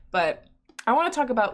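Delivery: ordinary talk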